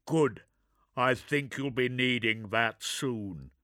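measured soldier's reply; gruff space marine. Acted.

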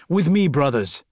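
loud battle call to comrades; rallying shout. A narrator's reading. Corvo calling brothers to the statue, rallying shout. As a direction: shout to bring your men along.

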